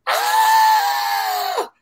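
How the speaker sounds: high piercing mock scream; retold girlfriend's high scream. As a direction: shriek like you just saw a ghost.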